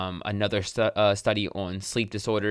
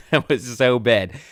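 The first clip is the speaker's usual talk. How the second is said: laughing; 3 semitones higher, 7 dB louder, slower than usual. Real, not acted. Laughs at own bad accent.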